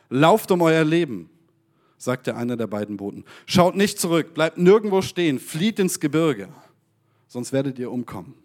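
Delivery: lively storytelling read aloud; dramatic Bible reading. Acted.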